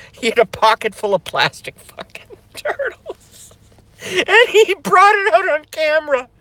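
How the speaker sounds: high, breaking into laughter; can't keep it together; cracking up again; laughing fit. Real, not acted.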